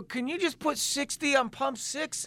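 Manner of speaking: slow, deliberate, overly patient ask; slow pleading request to the clerk; patient exasperation. Retold or imitated. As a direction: spell it out slowly to him. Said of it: "I go".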